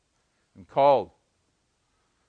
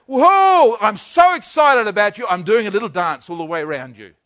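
excited delight; 6 dB louder than usual. Acted. God dancing around his children: excited joy. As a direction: gush over them with delight. God voiced rejoicing over us.

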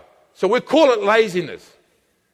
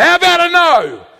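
defiant no; 8 semitones higher, 6 dB louder than usual. Acted. The believer rejecting temptation with a loud, high no. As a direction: shout the no in defiance. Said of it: "you raised your hand".

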